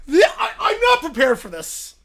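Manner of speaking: breaking up with laughter; can barely talk through giggles; laughing. Real, not acted. Host losing it at the bear's answer, laughing.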